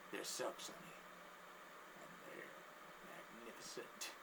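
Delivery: very soft, nearly whispered; hushed confiding. Acted. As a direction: confide it under your breath. Creepy guy confides in boys.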